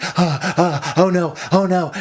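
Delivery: mock panic. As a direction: gasp it out in hammed-up distress. Acts out suffering from pepper.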